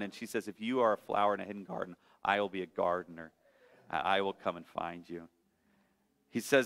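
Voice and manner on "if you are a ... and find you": lower, softer, fast tender mother; gentle mother bunny. Acted. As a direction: reassure the runaway child quietly.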